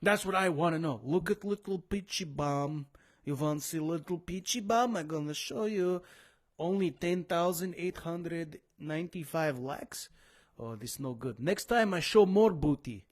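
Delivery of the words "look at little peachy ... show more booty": hammed-up Eastern European model voice; mock Eastern European accent. Model fishing for likes on her photo, mock Eastern European accent. Acting out the model in foreign English.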